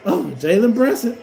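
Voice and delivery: ordinary delivery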